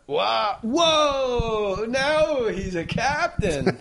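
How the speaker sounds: loud, high, drawn-out wow; hammed-up awe. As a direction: slowly stretch it out in mock reverence. Acted awe introducing a captain.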